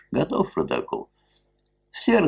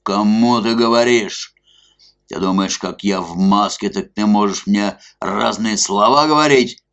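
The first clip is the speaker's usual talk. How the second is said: loud, higher, rowdy bellowing; angry shouting; 6 semitones higher, 7 dB louder than usual. A narrator's reading. Drunk masked man ordering the club officials out, bellowing. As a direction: throw them all out with a roar.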